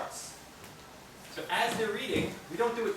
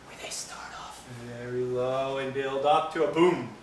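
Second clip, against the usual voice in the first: volcano whisper build; 3 semitones lower than usual. Shown as a demonstration. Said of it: "volcano reading".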